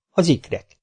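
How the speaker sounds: usual speaking voice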